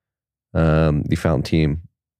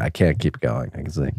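ordinary talk

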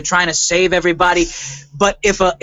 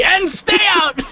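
high-pitched imitation of a yell; mock yell; 10 semitones higher, slower than usual. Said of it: "he's yelling at nobody".

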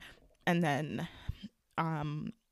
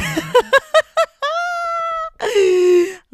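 high, loud nervous laugh; nervous burst of laughter; 10 dB louder than usual. Real, not acted. Podcaster laughing at herself before a confession. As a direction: crack up at her own stalling.

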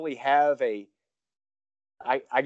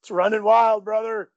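Hogan catchphrase; 9 semitones higher, 4 dB louder than usual. Pretend.

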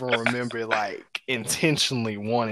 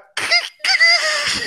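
mock wail; 6 dB louder than usual. Bursting into tears at climax.

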